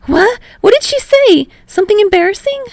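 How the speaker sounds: high, loud flustered panic over what mom said. Acted. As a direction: squeak it out in embarrassed alarm. Hinata panicking, embarrassed.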